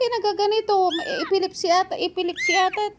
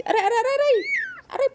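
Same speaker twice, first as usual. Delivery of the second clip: giggling through ouches; laughing ouch; 7 semitones higher, much faster than usual. Real, not acted. Owner laughing while the kitten scratches her, ouching. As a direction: laugh and wince at once. Cat clawing, laughing pain.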